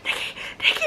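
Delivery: put-on whispering to wake sleeping boyfriend on the plane; 1 hushed urgent call. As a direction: whisper his name urgently. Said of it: "I was like".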